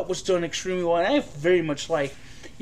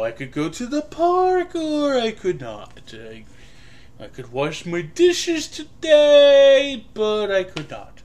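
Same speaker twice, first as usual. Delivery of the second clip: New York rabbi impression; 5 semitones higher, 4 dB louder, slower than usual. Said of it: "Jon Stewart doing a New York rabbi".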